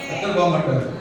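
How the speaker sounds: ordinary delivery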